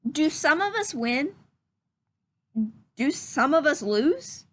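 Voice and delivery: lively, curious rising questions; questioning read-aloud voice. Acted. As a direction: read each question like a wondering child. Reads story questions aloud.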